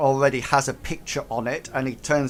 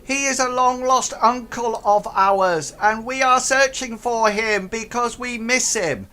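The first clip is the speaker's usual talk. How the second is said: much higher, louder goblin; earnest goblin; 9 semitones higher, 5 dB louder than usual. Acted. Goblin feeding the players a rehearsed story. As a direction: recite the cover story brightly. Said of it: "one of them turns around and says".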